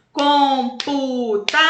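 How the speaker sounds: Computador split into com-pu-ta-dor, slow; syllable by syllable. Shown as a demonstration. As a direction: open the mouth once per syllable of Computador. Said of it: "Abre quatro". (Computador separado em sílabas, devagar)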